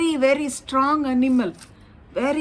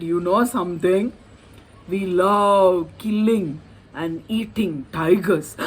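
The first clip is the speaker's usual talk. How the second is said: menacing bluff; 4 semitones lower than usual. Acted.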